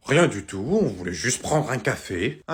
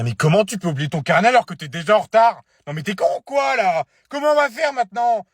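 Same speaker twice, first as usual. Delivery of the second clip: loud, high, exasperated outburst; furious; 7 semitones higher, 6 dB louder than usual. Acted. Parent learning the school notebook is forgotten, furious. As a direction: explode at the child, pitch climbing.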